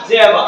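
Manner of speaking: "Java", said jeva, for did you ever; native contraction. Shown as a demonstration. Teacher quizzes students, loud contracted "Java". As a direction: punch out "Java" as one short word. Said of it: "ওরা বলে যে কি?".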